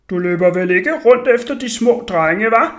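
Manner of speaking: loud, accusing mock adult; angry grown man. A narrator's reading. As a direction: interrogate him loudly like a cross grown-up. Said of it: "ligesom en rigtig voksen og gal mand".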